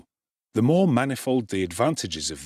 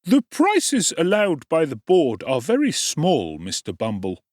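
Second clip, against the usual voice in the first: a raised, oily undertaker's voice; 7 semitones higher, 4 dB louder than usual. A narrator's reading. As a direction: play the undertaker a bit higher and brighter.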